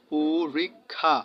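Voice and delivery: পুরীক্ষা said slowly with u after p, pu-rik-kha; pronounced purikkha, slowly. Shown as a demonstration. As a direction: stretch out পুরীক্ষা, starting on pu. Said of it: "পর শেষে ও উচ্চারিত হয়েছে". (ধীরে ধীরে পুরীক্ষা, প-এ উ-কার দিয়ে)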